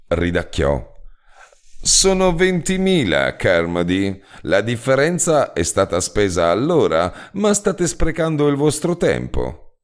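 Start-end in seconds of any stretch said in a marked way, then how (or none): 1.8-9.8 higher, lightly voiced director with a chuckle; the calm director correcting the sum, amused; insurance director. Director Lutin correcting Carmody with mild amusement.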